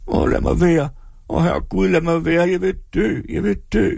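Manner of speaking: high, wailing despair; wailing woman. A narrator's reading. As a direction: plead to be left to die. (jamrende kvinde)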